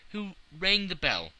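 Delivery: plain reading voice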